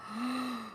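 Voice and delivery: soft intake of breath in wonder; amazed gasp. Acted. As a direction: gasp at a lovely surprise. Child amazed by the truck.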